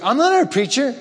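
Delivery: high, startled objection; startled protest. Retold or imitated. Michael told to be the preacher, protesting high and startled. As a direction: squeak it out in disbelief.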